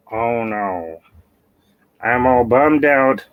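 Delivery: slow, mopey Squidward; depressed Squidward. Acted. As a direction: sigh it out, gloomy and slow. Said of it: "a depressed version of Squidward".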